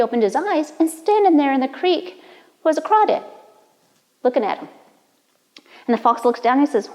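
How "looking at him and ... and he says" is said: plain reading voice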